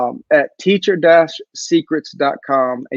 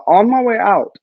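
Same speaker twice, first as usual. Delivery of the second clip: usual speaking voice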